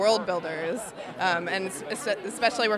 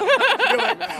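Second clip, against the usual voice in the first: high laugh; 7 dB louder than usual. Real, not acted. Laugh after joke.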